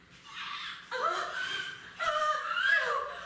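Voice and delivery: loud, high gasp breaking into a laugh; shocked gasp. Acted. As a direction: gasp in mock horror at the oven. Opens the oven, horrified.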